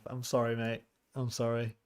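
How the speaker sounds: normal talking voice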